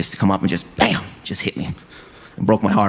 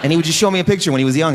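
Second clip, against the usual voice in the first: ordinary talk, nothing marked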